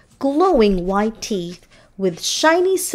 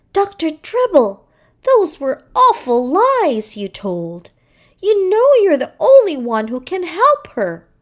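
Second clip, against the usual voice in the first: higher, reproachful scolding; scolding nurse; 7 semitones higher than usual. A narrator's reading. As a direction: tell him off for lying.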